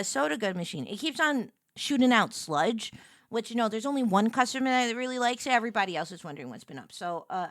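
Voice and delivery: ordinary talk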